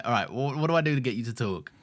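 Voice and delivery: ordinary talk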